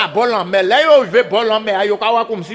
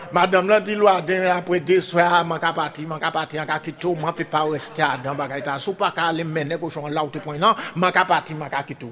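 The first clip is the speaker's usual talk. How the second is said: usual speaking voice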